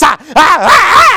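loud, high, slow preacher gasps; mock preacher shouts. Southern preachers preaching, mock shouted gasps. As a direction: ham up the breathy preaching shouts. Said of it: "they preach like this".

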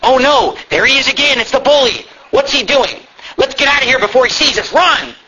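high, hammed-up alarm; scared kids. Kids spotting the bully, mock alarm. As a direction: build mock panic toward a cry to run.

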